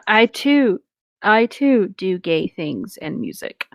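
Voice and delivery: normal talking voice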